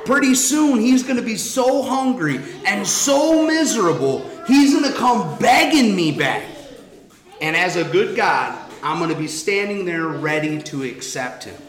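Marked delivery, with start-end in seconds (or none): 0.1-6.4 confident father. Acted. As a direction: boast that he'll come crawling back. Voicing father/God's confident prediction.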